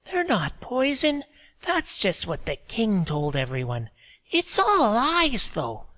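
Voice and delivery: put-on high, thin old woman's voice; old woman speaking through a laugh; old Meredy. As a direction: voice her as a frail old village woman. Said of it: "Meredy said".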